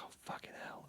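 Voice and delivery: whispered retold shock; very soft, whispered aside; hushed onlooker. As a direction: imitate a bystander swearing under their breath. Onlookers reacting as body is carried.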